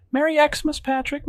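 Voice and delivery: very high falsetto squeal; shrieked greeting. A narrator's reading. Evelyn greeting Patrick with a kiss, shrieking high. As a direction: squeal it in party excitement. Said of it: "she shrieks".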